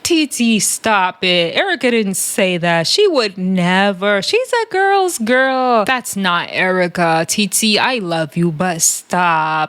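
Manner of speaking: slow, drawn-out imitation; Natalie's slow talk. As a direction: stretch the words like someone very over it. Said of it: "talking like this, very slow".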